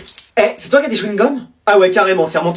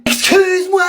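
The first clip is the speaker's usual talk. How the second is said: indignant high excuse-moi; 8 semitones higher than usual. Acted. Mother outraged at dad.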